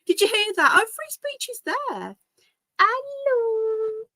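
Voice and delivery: very high, excited squeak; high squealed hello; delighted pet talk. Real, not acted. Showing dog's hello.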